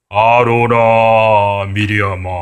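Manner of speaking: very slow, drawn-out divine call; God calling Aaron and Miriam, slow and echoing. Acted. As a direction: call out the names slowly, like God from the cloud. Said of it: "하나님 목소리 같아요?".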